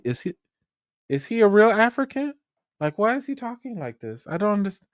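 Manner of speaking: high-pitched mock panic; freaked-out executives. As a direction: act out bewildered executives calling for help. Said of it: "the executives as they're like".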